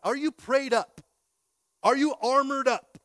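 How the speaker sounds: loud, high rallying call; loud rallying cry; preacher's shout. Acted. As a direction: rouse the crowd to battle. Preaching climax.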